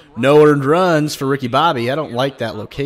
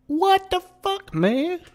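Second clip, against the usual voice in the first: very high, squeaky-pitched bafflement; exasperated disbelief; 3 dB quieter, slower than usual. Real, not acted. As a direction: pitch shoots up in baffled protest. Baffled by bad stats.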